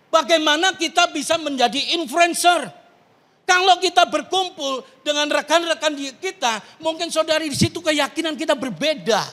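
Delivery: forceful preacher's rhetorical questioning; preaching exhortation. Acted. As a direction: urge the congregation on.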